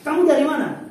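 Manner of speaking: loud, high blunt question; NTT questioner. Retold or imitated. NTT person asking a Javanese where they're from, loud and high. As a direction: fire the question out so it sounds angry. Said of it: "Kalau orang N T T".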